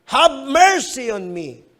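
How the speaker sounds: preacher demonstrating how the blind man should shout; shouted plea. Shown as a demonstration. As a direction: cry out for help as loud as you can. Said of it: "dapat malakas kang sumigaw".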